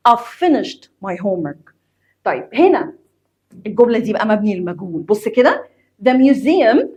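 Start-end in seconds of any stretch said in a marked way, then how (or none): 0.1-1.7 slow model with "I've" said as "av"; slowed native-style "av finished" for "I've finished"; contracted pronunciation. Shown as a demonstration. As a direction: say "I've" as one syllable "av", slowly. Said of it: "أقولها كأنك بتقول A و V".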